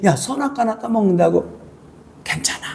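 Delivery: soft, coaxing tempter voice; Satan tempting softly. Acted. Satan coaxing Eve to eat the fruit, soft. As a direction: coax her quietly, almost under the breath.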